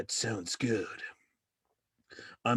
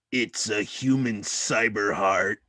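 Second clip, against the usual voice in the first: normal talking voice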